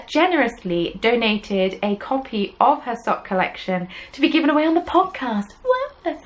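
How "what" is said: very high-pitched playful "what"; mock amazement. Host announcing a podcast giveaway, mock amazement. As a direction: squeak it in pretend astonishment.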